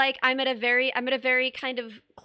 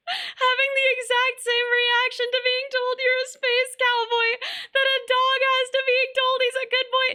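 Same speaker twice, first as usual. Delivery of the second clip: much higher and louder, gushing; excited; 11 semitones higher, 5 dB louder than usual. Real, not acted. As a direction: read it out with squealing delight. Reading chat message excitedly.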